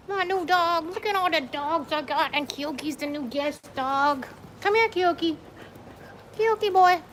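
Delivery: high, sing-song pet voice. Real, not acted. Talking sweetly to her dogs.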